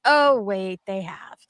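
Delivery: ordinary talk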